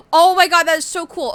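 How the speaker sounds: high, loud thrilled gush; excited. Real, not acted. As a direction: burst out with delight. Thrilled at finding the game.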